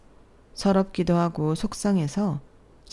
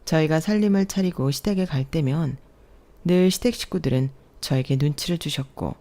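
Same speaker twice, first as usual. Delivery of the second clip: ordinary delivery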